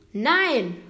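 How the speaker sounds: put-on loud, high little girl cry; calling out. A narrator's reading. Girl crying out to the threatening bear, loud. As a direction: blurt it out loud to stop him. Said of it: "ruft Marie".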